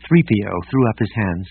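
ordinary delivery

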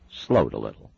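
plain reading voice

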